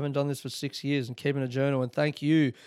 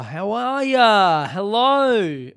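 slow, loud, higher, stretched "How are ya?"; loud drawn-out greeting; 6 semitones higher, 6 dB louder, much slower than usual. Shown as a demonstration. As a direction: drag out "How are ya?" like calling to a crowd. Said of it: "Just fucking talk to the people".

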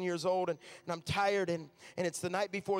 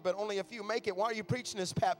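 usual speaking voice

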